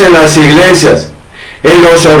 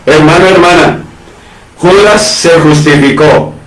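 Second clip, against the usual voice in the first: usual speaking voice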